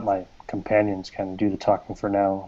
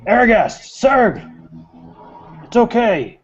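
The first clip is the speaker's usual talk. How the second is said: high, loud call to the door; 12 semitones higher, 5 dB louder, slower than usual. Acted. Warrior calls his companions in from the door, loud. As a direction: call them in from outside. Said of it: "And I'll say".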